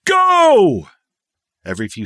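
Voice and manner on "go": high, loud sharp shout; shouted command. A narrator's reading. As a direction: bark the jump order. Jump order given.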